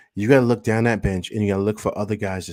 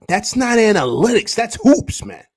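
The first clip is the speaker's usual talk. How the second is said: much higher and louder, punching the point home; excited rant; 10 semitones higher, 6 dB louder than usual. Real, not acted. Streamer ranting to chat about the coach, heated excited shout. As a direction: shout it like it's obvious.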